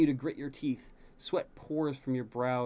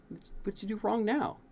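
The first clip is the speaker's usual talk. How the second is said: a higher, mock-curt teacher voice; prim tutor's dry question; lightly voiced teacher; 9 semitones higher than usual. A narrator's reading. Tutor silently scolding the prince, prim voice.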